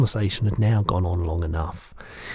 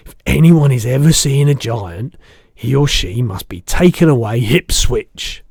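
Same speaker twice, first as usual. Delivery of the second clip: the giant's voice; 4 semitones higher, 11 dB louder than usual. Acted. Giant correcting the girl, big booming voice.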